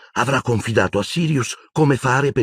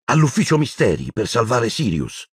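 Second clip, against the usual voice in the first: pitched up in insistence, not a shout; raised voice; 6 semitones higher than usual. A narrator's reading. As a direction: raise the voice to press the point. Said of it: "Harry alzò la voce". (alzando la voce, insistente)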